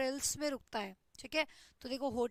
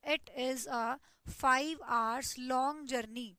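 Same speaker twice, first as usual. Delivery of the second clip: very slow, word by word; deliberate teacher dictation pace; much slower than usual. Shown as a demonstration. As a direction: dictate the example slowly. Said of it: "जैसे कि देखो यहाँ पे".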